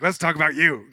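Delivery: loud, high mock insistence; eager deflection. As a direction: push the attention loudly onto them. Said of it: "my initial, like".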